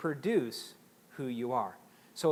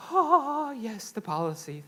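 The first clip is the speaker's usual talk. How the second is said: high, starstruck mock gratitude on the Oh; hammed-up awe at a new policy; mock fawning delight; 6 semitones higher, 5 dB louder, slower than usual. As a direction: gush over it like a treasure.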